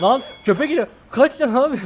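high, loud panicked shout; panicked yelling. Real, not acted. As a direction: yell in sudden panic as the dog comes. Dog chasing him in game.

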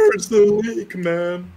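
put-on high, loud drunken bellow; mock drunk voice. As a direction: shout it like a sloshed regular. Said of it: "I'll have to put on a mock drunk voice".

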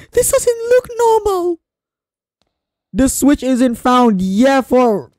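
very high loud slow squeal; high loud frightened squeak; panicked squeal. Real, not acted.

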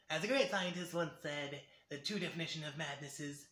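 mad scientist line. Shown as a demonstration. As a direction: deliver it like a scientist losing his mind. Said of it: "that's basically the line".

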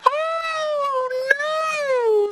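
very high crying fly; tiny squeaky wail. Acted. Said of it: "said the little fly".

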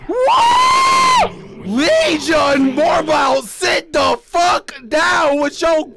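high loud panicked screams; real terrified screaming; screaming in fright. Real, not acted. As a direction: scream and curse in terror. Jump scare, screaming.

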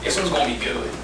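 low, soft aside; quiet aside. Acted. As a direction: say it under your breath.

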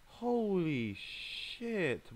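slow, high, stretched exclamation; stunned. Real, not acted. Player reacting to a brutal match, stunned. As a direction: drag out each word in shock.

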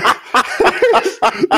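loud high laughing fit; helpless laugh. Real, not acted. Laughing helplessly while retelling the crack offer to his co-host. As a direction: lose it completely.

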